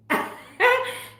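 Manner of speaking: high giggle bursting out; high delighted laugh. Real, not acted. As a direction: laugh up high at the pet's innocent face. Laughing at her pet.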